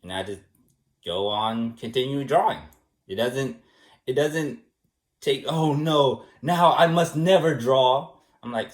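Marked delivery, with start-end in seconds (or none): 5.5-8.1 drawn-out, overdramatic mock despair; mock melodrama. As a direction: ham up a tragic vow, slow and heavy. Mocks an overdramatic reaction to criticism.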